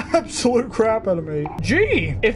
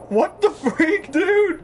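stunned; 6 semitones higher, much slower than usual. Real, not acted. Reacts to real names.

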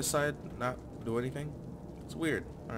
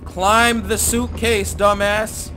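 angry command; 6 semitones higher, 10 dB louder, much slower than usual. Real, not acted. Ordering the game character.

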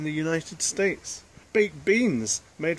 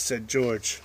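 ordinary delivery

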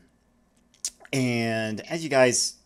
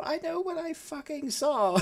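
put-on high, stubborn kid's insistence; little girl refusing to back down; defiant child. Little girl insisting to her mom as you leave. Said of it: "basically go".

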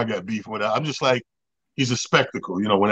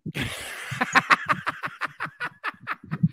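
silent wheeze laugh; 12 semitones higher, 9 dB quieter than usual. Real, not acted. Wheezing laugh at his own story.

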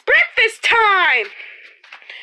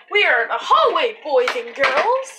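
usual speaking voice

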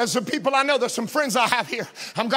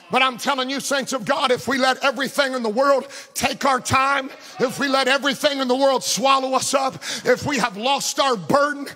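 preaching; 3 semitones higher than usual. Acted. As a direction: press the altar call home. Preaching build to altar call.

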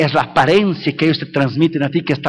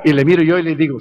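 normal talking voice

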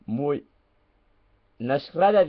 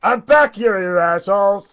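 mock yell; 5 semitones higher, 5 dB louder than usual. Bursts back in yelling at chat.

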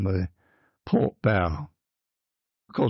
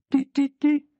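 three short high beeps; imitated alarm buzzer; 3 dB louder than usual. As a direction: mimic a buzzer in quick, high bursts. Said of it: "short bursts for S".

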